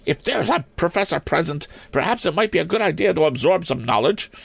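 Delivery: put-on high-pitched, prim old maid principal; severe schoolmistress. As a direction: demand it in a high, stern headmistress voice.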